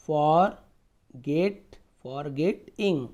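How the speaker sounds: Forget stretched slowly and loudly; slow sounded-out word. Shown as a demonstration. Teacher sounding out forget, slow and loud. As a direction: pull forget out slowly and firmly. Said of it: "teacher sounding out word".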